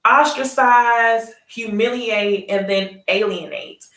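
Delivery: each word drawn out slowly; slow and deliberate. Shown as a demonstration. Recapper slowly lays out the leader's saying for viewers. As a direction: spell out the three steps slowly. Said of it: "what is it?".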